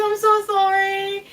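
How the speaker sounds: high, loud, drawn-out mock sob; wailed apology. Crying client apologising to makeup artist, mock wail. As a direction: plead sorry in a hammed-up wail.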